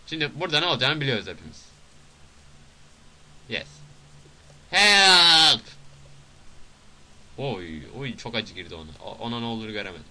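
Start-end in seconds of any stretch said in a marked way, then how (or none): 4.7-5.6 loud, high, drawn-out yell; mock cry for help. Player crying out for a game character, mock help shout. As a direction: holler for help at full volume.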